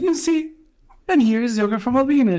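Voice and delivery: high, quick mock voice of the elderly mother; imitating the mother. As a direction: raise the pitch way up and rattle it off. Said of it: "And she's like".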